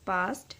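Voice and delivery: पास्ट said slowly and clearly, teacher demo of the st sound; example word said slowly. Shown as a demonstration. Teacher demonstrating a word for shorthand students, careful and clear. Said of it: "पास्ट और बेस्ट हमने एसटी की loop के साथ बनाया".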